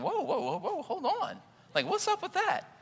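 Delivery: mock indignant. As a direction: play up being taken aback by their tone. Reacting to someone's tone.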